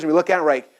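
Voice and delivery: normal talking voice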